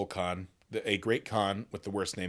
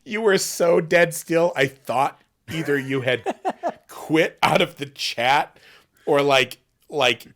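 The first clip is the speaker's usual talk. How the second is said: excited laughing retelling; 3 semitones higher, 6 dB louder than usual. Real, not acted. Excitedly recounts friend's frozen reaction.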